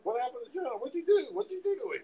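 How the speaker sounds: very high mock interrogation; imitated outraged coworkers; pissed-off coworkers. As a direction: fire accusing questions in a high voice. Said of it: "everybody's pissed off at me".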